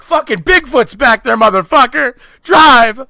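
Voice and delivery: frantic shouting at the driver; mock panic, screaming drive; panicked yell. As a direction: yell it like a monster's chasing you. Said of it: "You were like".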